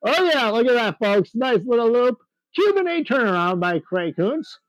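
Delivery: lively live play-by-play for the crowd; air show announcer. Acted.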